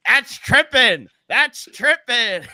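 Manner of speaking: high, loud, drawn-out mock heckle; imitating a heckler. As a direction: chirp it like a heckling hockey fan. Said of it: "He kept calling trip".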